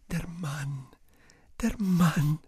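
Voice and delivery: high airy whisper. Acted. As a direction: whisper it among yourselves. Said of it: "unter sich flüsternd".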